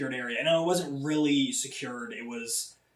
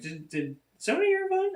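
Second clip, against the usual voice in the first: much higher, put-on questioning voice; mock instructor voice; faster than usual. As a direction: play the instructor fishing for the culprit. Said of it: "she was like".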